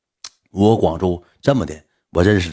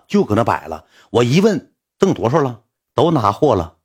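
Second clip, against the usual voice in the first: usual speaking voice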